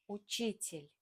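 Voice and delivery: учитель said u-CHI-tel, stress on chi; uCHItel, lean on chi; stress on the second syllable. Shown as a demonstration. Teacher shows learners: учитель stressed on chi. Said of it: "For example".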